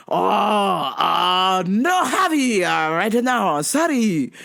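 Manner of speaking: thick mock Japanese accent, halting fillers. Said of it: "lay it on there".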